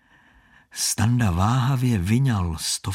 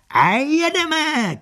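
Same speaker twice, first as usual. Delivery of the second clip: much higher, louder, stretched call; shout; 4 dB louder, slower than usual. Acted. Con man launching the shell game with a loud high cry. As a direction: call it out like a showman. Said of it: "křikl muž".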